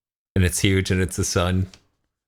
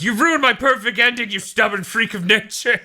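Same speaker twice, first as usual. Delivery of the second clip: mock outraged yell; 12 semitones higher than usual. Retelling the villain's line to a co-host, mock outraged yell. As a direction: imitate an indignant cartoon yell, pitched way up. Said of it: "screams at him and flies out".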